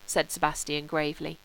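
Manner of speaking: ordinary delivery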